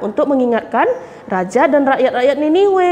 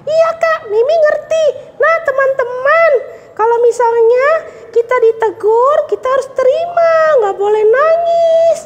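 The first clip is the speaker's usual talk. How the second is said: put-on high child voice, cheerful lesson; puppet girl Mimi; 9 semitones higher than usual. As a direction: teach friends like a proud little girl. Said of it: "Mimi udah ngerti kan".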